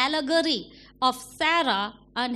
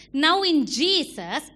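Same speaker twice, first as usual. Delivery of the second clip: ordinary delivery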